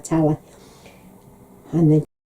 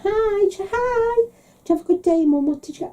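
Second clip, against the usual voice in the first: much higher, sing-song phone greeting; imitating herself; 14 semitones higher than usual. Daughter phones mum at night, bright sing-song. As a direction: chirp it sweetly down the phone.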